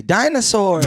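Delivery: mock little boy yelling one word; kid's shout. Kid shouting his name.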